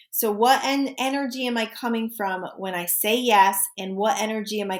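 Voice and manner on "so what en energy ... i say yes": ordinary talk, nothing marked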